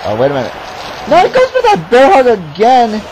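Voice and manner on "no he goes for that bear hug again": high, raised play-by-play; excited match call. Real, not acted. Commentator seeing another bear hug, excited high call. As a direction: cry out the move in disbelief.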